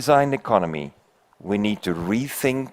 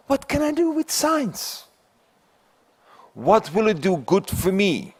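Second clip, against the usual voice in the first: high squeaky little mouse voice, much higher; 11 semitones higher than usual. Acted. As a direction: play a tiny, curious mouse piping up. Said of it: "The mouse looks up and says".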